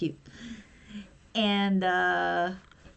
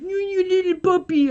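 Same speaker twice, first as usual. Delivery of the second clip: high-pitched gushing coo; cooing puppy talk; 8 semitones higher than usual. Acted. Gushing to viewers over how adorable Denji is, high cooing. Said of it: "Denji is more like".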